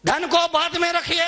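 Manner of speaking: high, sustained preacher's call; emphatic exhortation. Real, not acted. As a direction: ring out the maxim's second half. Maxim's second half, raised. (ऊँचे, खिंचे स्वर में सीख)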